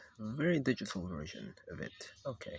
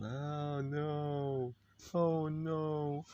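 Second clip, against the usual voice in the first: drawn-out, higher mock worry; mock dismay; 4 semitones higher, much slower than usual. Mocking the character's threat, drawn-out pretend dismay. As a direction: wail it lightly in pretend dread.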